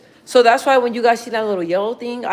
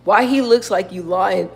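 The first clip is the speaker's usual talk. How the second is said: normal talking voice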